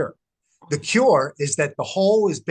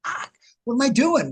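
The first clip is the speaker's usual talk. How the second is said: self-reproach; 10 semitones higher than usual. Retold or imitated. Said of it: "I was wrong".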